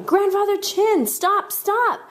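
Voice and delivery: high, insistent calling; still shouting. A narrator's reading. Kids dashing upstairs call to grandfather, high insistent shouting. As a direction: keep crying out urgently, very high. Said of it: "still shouting".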